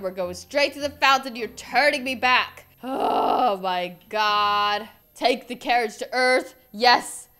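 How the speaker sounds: usual speaking voice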